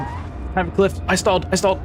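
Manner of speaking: high panicked wailing. Real, not acted. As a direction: cry out in alarm. Stalled at cliff edge.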